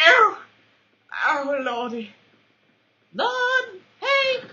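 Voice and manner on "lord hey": high squeak. Pretend. High pitched exclamation.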